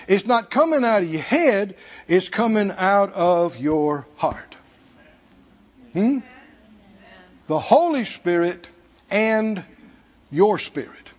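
normal talking voice

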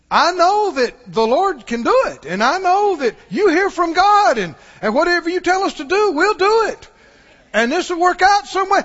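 faith-filled tone. Shown as a demonstration. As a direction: sound sure that God will come through. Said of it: "Can you hear the difference in the tone".

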